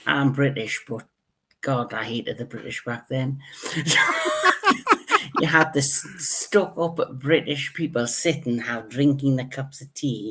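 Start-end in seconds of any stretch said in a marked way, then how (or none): 3.5-5.3 very high squeal of laughter; shrieking laugh. Real, not acted. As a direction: burst out laughing. Laughs at hating the British.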